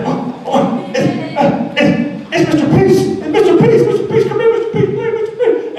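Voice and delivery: put-on high, excited little kid calling out; excited first grader. First grader spotting Mister Peace across the lunchroom, high excited calling. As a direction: squeal it like a thrilled seven-year-old. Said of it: "he's like".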